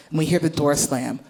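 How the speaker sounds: normal talking voice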